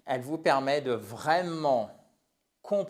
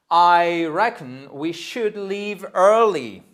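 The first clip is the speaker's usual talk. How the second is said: loud, higher, slow demonstration; slow model sentence; 4 semitones higher, 9 dB louder, slower than usual. Shown as a demonstration. Teacher giving a British English example, slow loud model. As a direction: project it word by word, drawn out.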